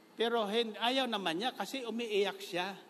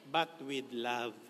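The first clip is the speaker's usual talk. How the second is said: gentle but with love; 6 semitones lower, slower than usual. Shown as a demonstration. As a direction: ease the anger into tenderness, slowly. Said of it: "Hindi man pwedeng hindi tayo magagalit".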